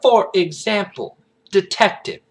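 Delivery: high, loud sassy Southern woman; Agent Bloomis. Acted. Agent putting a detective in his place, sassy Southern. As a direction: needle him in a Southern accent. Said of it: "what I'm saying with sassy".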